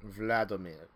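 name pronounced with a put-on Russian accent. Shown as a demonstration. Reader showing the name on request, Russian accent. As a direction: say Vladimir as a Russian would. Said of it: "I like the accent".

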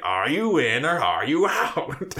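slow, theatrical villain challenge; Green Goblin. Retold or imitated. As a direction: draw out each word with a hammy villain's menace. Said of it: "And then he's like".

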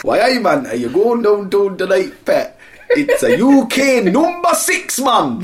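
high laughing mock Geordie. Mock UK chart host, high laughing Geordie. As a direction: ham up the Geordie while laughing. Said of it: "I'll just pick one for the U K".

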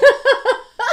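high, loud burst of laughter; real laugh. Real, not acted. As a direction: crack up helplessly. Co-host laughs at being teased.

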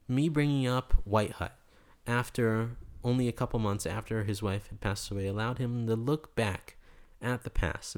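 normal talking voice

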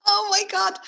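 a high burst of disbelief. Real, not acted. Host reacting to her friend's rant, high and surprised.

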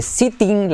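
sitting with the first i said like e. Shown as a demonstration. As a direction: say sitting as seh-ting. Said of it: "la vamos a hacer como sitting".

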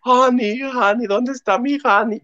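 slow, put-on whining for honey; mock martyr whine. Daughter pining for her husband in a slow mock whine. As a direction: play the self-pitying daughter, drawn out. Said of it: "haciéndose la mártir de".

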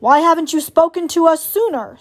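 a touch of owl screech. A narrator's reading. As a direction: call it down accusingly from the treetop. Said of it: "screeched the owl".

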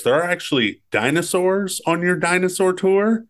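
high, incredulous mock question; Malcolm impression. Podcaster quoting Malcolm in the car, high mock amazement. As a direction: act amazed and sarcastic into the camera. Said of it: "and goes".

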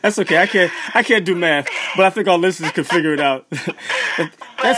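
loud laughing while talking. Real, not acted. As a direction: laugh through the words. Laughs at age joke.

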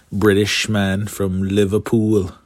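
half-joking attempt at British English; bad British accent. Shown as a demonstration. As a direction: put on a clumsy, hammy British accent. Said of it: "That's my little British accent there. It's bad, but whatever".

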